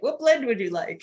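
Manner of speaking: a hint of a cheerful server changing the subject; chirpy host voice. Son sidestepping his parents' spat, mild chirpy imitation. Son breezily offering coffee.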